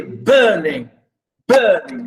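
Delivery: "burning" with a strong, long first syllable; stress on BURN. Shown as a demonstration. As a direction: lean on the start of "burning": BURN-ing. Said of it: "stress on burn".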